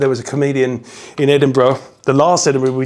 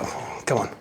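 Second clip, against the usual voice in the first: muttered complaint; 5 semitones lower, 10 dB quieter than usual. Retold or imitated. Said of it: "I'd be like".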